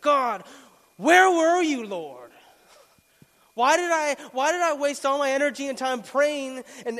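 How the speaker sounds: loud, high, raging accusation; angry outcry at God. Retold or imitated. After slamming his fist, shouting at God in anger. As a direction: pour out the anger like he's still pounding the desk. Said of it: "I was so angry".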